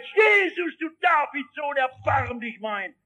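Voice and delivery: high crying shout, fading toward the end; bellowing. Acted. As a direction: beggar crying out over the crowd. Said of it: "der Blinde schreit".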